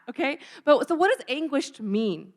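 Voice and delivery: normal talking voice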